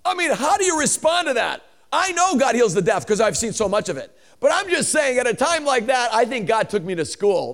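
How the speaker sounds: high, loud, impassioned preaching; full-force preaching. Real, not acted. Preacher admits to congregation God schooled him, impassioned.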